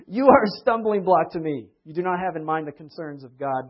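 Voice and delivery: loud, commanding rebuke; stern loud rebuke. Retold or imitated. Jesus rebuking Peter before the twelve, loud and stern. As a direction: thunder the rebuke at him.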